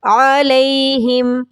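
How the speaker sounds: علیہم chanted slowly with the ay glide; correct aleihim. Shown as a demonstration. Teacher showing students the correct way. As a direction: recite علیہم slowly with the ay sound. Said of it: "اس کو علیہم نہیں پڑھنا".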